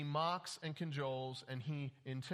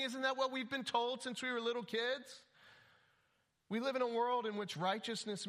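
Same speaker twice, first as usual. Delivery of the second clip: much higher, protesting put-on voice; indignant objector; 7 semitones higher than usual. Preacher imitating a listener who resents being rebuked, indignant. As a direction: play the offended listener who won't be told.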